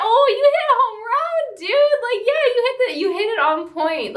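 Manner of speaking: squealing high-pitched and loud with excitement; thrilled gush. Real, not acted. Reviewer raving about a movie line, squealing with excitement. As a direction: gush like your favourite character just nailed it. Gushing over the son's comeback line.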